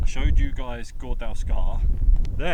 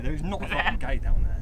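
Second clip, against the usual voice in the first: usual speaking voice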